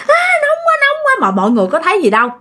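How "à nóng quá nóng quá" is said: very high wail of pain, reenacted; retold screaming. Possessed mother before onlookers, retold high wail of heat. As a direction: act out a high burning shriek. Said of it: "mà mẹ cứ".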